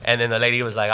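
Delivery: ordinary talk, nothing marked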